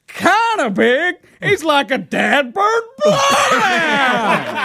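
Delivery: high, drawn-out hollered impression; exaggerated high country yell; mock outburst. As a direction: holler it in comic outrage, stretching the last word. Acting out Greg's outraged comic outburst.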